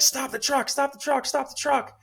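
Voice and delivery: high urgent shout, repeated; urgent yell. Retold or imitated. Retelling dad pounding the truck, high urgent repeated shout. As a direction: call it out high and pressing, like banging on the truck. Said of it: "He's like".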